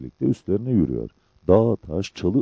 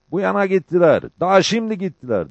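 high, loud pursuer's cry; urgent calling out; 11 semitones higher, 6 dB louder than usual. A narrator's reading. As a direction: call out the fugitives' direction urgently. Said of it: "diye durmadan konuşuyordu". (tiz ve yüksek sesle seslenerek)

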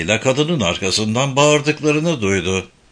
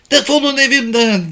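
much higher, louder furious yell; furious shout; 12 semitones higher, 4 dB louder than usual. A narrator's reading. Woman screams at the intruders to get out of her house, furious shout. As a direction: scream them out of your house. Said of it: "diye öfkeyle bağırdı kadın".

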